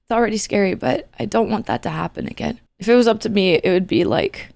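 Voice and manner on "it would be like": normal talking voice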